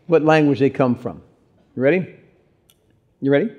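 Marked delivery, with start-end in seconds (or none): none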